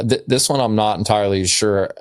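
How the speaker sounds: ordinary delivery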